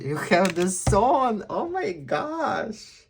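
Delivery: thrilled by the card, stretch it out slowly; excited gasp. Real, not acted. Tarot card reveal, delighted.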